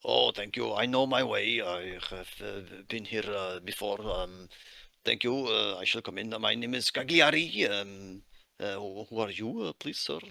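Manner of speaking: halting, polite foreign worker asking the way; Russian accent. Acted. Miner NPC continues.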